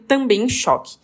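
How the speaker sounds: normal talking voice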